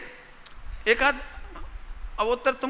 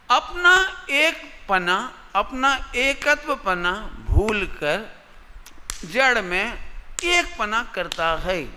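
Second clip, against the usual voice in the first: slow emphasis; 2 semitones higher, slower than usual. Shown as a demonstration. Teacher stresses key phrase slowly.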